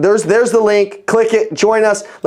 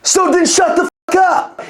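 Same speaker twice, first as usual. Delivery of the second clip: fed-up snap; 10 semitones higher than usual. Retold or imitated. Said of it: "And I'm like".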